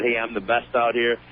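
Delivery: usual speaking voice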